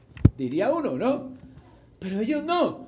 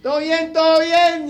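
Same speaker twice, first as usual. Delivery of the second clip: loud, higher repeated call; 7 semitones higher, 9 dB louder than usual. Acted. Paul shouting reassurance to the jailer. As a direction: shout it across the prison. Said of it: "una voz que le dijo".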